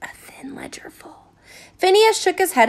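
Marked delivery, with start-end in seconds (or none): 0.0-1.2 breathy aside, all air, no voice; sly whispered aside to the reader. A narrator's reading. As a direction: hush it so only she hears the joke. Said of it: "Then she added in a whisper".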